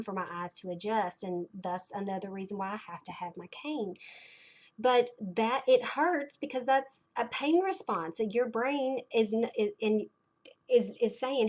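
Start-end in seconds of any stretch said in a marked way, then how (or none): none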